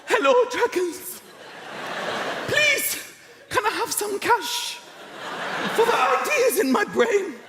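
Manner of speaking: high, slow, timid begging voice; trembling. Acted. Poor person begging rich dragons for cash, trembling. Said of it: "They come in trembling".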